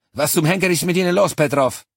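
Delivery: loud, high furious demand; angry shout. A narrator's reading. Cortez yells at the sobbing Petrov, angry shout. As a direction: yell at the broken soldier. Officer yells at panicking soldier.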